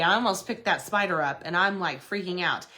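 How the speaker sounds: ordinary delivery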